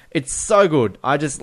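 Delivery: ordinary talk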